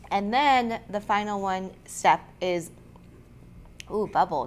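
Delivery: froggy, catchy voice with a frog in the throat. Real, not acted. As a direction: speak through a frog in the throat. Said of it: "You got a frog in your throat".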